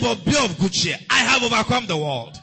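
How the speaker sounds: loud, high, driving sermon shout; full-force preaching. Acted. Preacher rousing the congregation against persecution, shouting. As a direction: preach it with rising fire.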